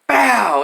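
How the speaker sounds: loud, high mock bat-crack noise; bat crack sound. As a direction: pop it out like the bat hitting the ball. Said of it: "I'm like".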